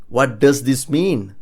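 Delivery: "What does this mean" slow, each word separate; slow down and fully sound "What does this mean"; slow model. Shown as a demonstration. Teacher modelling a question about Japanese writing, slow and clear. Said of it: "you ask someone who knows Japanese this".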